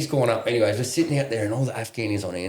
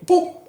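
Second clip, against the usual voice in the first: a quick high-pitched "boop" with a laugh; mock sound effect; 5 dB louder than usual. Mimicking the gun at his head.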